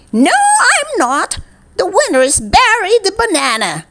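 high triumphant cry; triumphant shout. A narrator's reading. Umpire declaring the banana winner, triumphant. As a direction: crow the winner's name. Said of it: "he shouted triumphantly".

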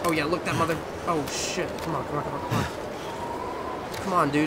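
high, loud frantic urging; frantic, rushing to get away; panicked. Real, not acted. Wolf chasing him, panicking.

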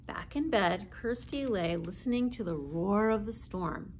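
ordinary delivery, nothing marked

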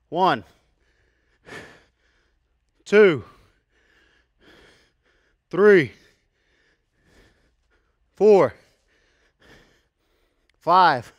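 very loud count, each number falling; calling out reps. Real, not acted. Trainer counting reverse lunges, calling out loudly. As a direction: belt out each rep under the strain.

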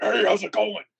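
loud, high shouted hey; aggressive character greeting. Retold or imitated. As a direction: bark it like a monster jumping out. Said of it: "I would normally just be like".

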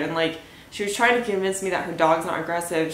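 normal talking voice

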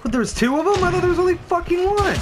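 real shocked outburst. Real, not acted. Surprised by a second enemy.

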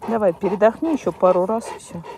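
usual speaking voice

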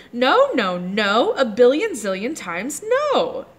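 a loud, high shout of refusal; yelled no. Acted. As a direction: yell it like a furious little girl. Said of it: "yelled Lulu".

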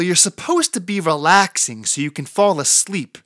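ordinary delivery, nothing marked

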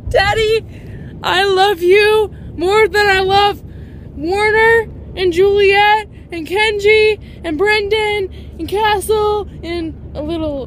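shower dad with grateful love; gushing thanks. Real, not acted. Thanking her dad for getting the book, loud joyful gushing.

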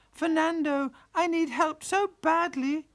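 high, pleading wail of a tearful pig; high, slow tearful wail asking the fox for help. Acted. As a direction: wail it like a sow in tears. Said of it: "she wailed".

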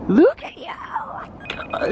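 high breathy whispered coo. Real, not acted. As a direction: whisper sweetly up high to the pup.

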